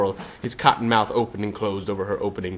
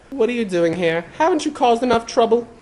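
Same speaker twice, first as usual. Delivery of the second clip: put-on high, gentle boy voice; Harry voice; 13 semitones higher, 3 dB louder than usual. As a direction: coax her through the door.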